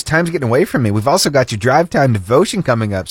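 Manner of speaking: ordinary talk, nothing marked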